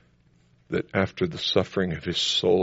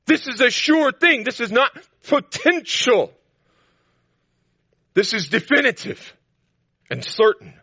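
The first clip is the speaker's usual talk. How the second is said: high and loud, hammering the promises; emphatic preaching; 9 semitones higher, 6 dB louder than usual. Acted. Preacher to the congregation, insisting high and loud. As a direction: drive home every "I will".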